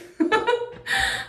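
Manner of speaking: high, airless chuckle; a voiceless laugh at her own joke; breathy laugh. Real, not acted. Vlogger to viewers after a joke about dancing, with a breathy laugh.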